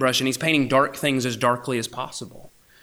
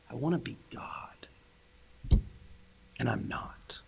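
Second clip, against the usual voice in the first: whispered; 2 semitones lower, 10 dB quieter than usual. Real, not acted. Preacher confessing to students in a whisper. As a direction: let it fall to a whisper.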